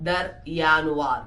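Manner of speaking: "Januar" with the J said as a Y sound, YA-nu-ar; teacher modelling "der Januar", J like English Y; German pronunciation. Shown as a demonstration. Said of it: "യോട്ട് എന്നാണ് ജെയുടെ pronunciation".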